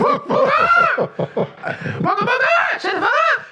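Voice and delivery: high, slow, laughing yell; laughing shout. Real, not acted. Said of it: "laughing protest".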